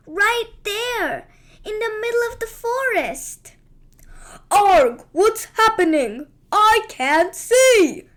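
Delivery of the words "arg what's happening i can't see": loud, slow dragon bellow; dragon roar. Acted. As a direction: roar it out, blinded. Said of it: "the dragon roared".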